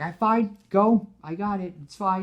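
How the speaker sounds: high, clipped resigned lines; hurt resignation. Retold or imitated. Gwen lets Peter go, high resigned. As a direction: give in, stung.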